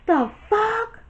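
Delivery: replaying the moment of walking in on them, shocked; retold shocked outburst. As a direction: jump up in pitch like you just caught them. Catching fiance in bed.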